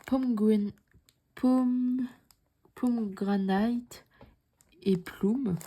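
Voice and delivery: halting English with a French accent. Real, not acted. Vlogger reading out an English pattern name, French accent. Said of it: "désolée pour mon accent anglais".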